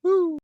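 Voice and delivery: very high, loud "woo"; sudden high whoop. Real, not acted. Streamer after talk of coffee, high loud whoop.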